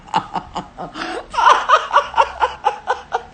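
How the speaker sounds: long, very high laughing fit; high cackle. Real, not acted. Laughing off being called names by a viewer, high cackle.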